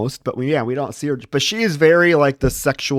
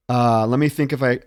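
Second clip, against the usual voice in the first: ordinary delivery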